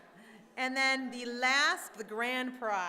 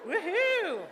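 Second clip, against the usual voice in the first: excited cheer; 9 semitones higher, 6 dB louder than usual. Real, not acted. Prize announced.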